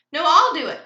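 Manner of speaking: high, very loud interruption; crying out. A narrator's reading. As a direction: shout over the others eagerly. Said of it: "cried Kevin".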